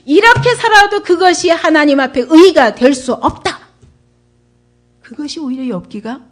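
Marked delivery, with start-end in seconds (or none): 0.1-3.6 loud, forceful preaching, slowing on the last words; emphatic. Real, not acted. Preacher tells congregation good living is not righteousness, emphatic. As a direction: drive the verdict home at full voice. Preacher drives home the main point.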